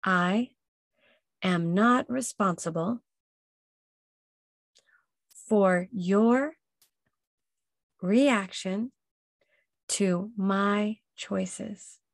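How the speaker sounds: I am not responsible said word by word with long pauses; a slow mantra for the listener to echo; slow, paced affirmation. Shown as a demonstration. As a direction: lead them through the phrase, one word at a time. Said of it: "repeat after me".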